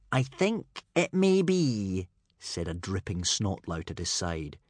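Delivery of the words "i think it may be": slow, higher smug character voice; secretly smiling. Acted. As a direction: draw it out with a secret smile. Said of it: "with a secret smile".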